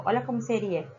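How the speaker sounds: ordinary talk, nothing marked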